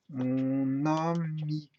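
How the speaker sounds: "Mon ami" very slow and sustained; syllable breakdown. Shown as a demonstration. Teacher showing the split, "Mon ami" drawn out. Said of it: "Je peux décomposer ça comme ça".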